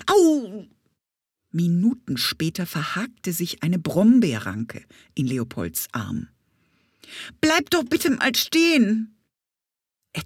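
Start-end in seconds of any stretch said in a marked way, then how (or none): 0.1-0.7 higher, louder yelp of pain; a quick cry as the thorn bites; sharp ouch. Acted. Boy pricked by a bramble, yelping ouch. 7.4-9.1 higher pleading call to stop. Acted. Hurt boy calling after the bee, pleading.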